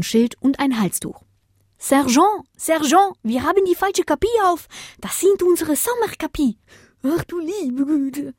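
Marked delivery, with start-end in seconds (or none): 1.8-6.6 much higher alarmed soldier voice; a legionnaire dog alarming his sergeant; French legionnaire. Acted. Legionnaire reports mistake. 7.0-8.3 higher, slow dismayed sigh; murmured dismay. Acted. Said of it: "murmelte der".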